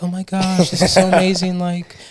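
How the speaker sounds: playful mock awe; mock gushing TV guest. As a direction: gush like an overwhelmed TV guest. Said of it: "They're, like, crying".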